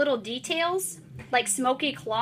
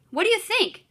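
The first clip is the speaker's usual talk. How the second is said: higher, scornful impatient question; impatient and bossy; 8 semitones higher, 3 dB louder than usual. Shown as a demonstration. Teacher voicing Paul mocking his brother, impatient and bossy. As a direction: make the kid feel stupid. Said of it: "making my voice sound a little impatient and bossy".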